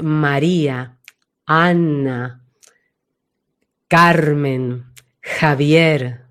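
each name low, long and falling, placed at the back; nombres con profundidad. Shown as a demonstration. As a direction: let every name sink deep and resonate behind. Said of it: "Tienen mucha profundidad". (nombres graves, profundos, desde atrás)

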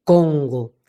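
"Congo" low and dark behind the palate; deep back-placed "Congo"; back resonance. Shown as a demonstration. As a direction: sink "Congo" to the back station. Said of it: "ahí detrás por el paladar".

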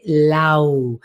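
"Lau" low, held inside; inward-sinking "Lau"; voice going inward. Shown as a demonstration. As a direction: pull LAU back and in. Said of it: "va más hacia adentro".

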